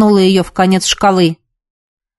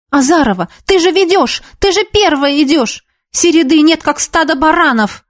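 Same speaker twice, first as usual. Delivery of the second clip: high-pitched teacher's reprimand; scolding; 7 semitones higher than usual. A narrator's reading. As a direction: berate the dance class. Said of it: "Народница ругалась". (ругается, как учительница)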